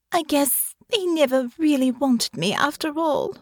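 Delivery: high, forlorn sniffling voice; dejected lady confiding in her friend; miserable. A narrator's reading. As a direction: say it as if all hope is gone. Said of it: "she said miserably".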